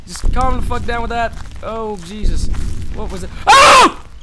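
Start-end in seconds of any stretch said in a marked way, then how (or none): 3.5-4.1 sudden high yelp; startled scream. Real, not acted. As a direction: jump and yelp in fright. Sudden scare in game.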